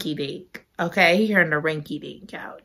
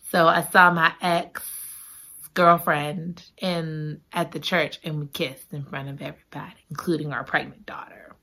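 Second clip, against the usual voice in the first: hushed confession; 5 semitones lower, 5 dB quieter than usual. Retold or imitated. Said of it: "she tells him".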